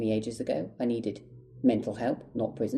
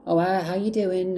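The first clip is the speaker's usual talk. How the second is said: high, louder imitation of mum; mum on the phone; 8 semitones higher, 5 dB louder than usual. Mum calling her child in prison, high mimicked voice. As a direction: mimic mum's phone voice, pitched well up. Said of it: "My mum would call me".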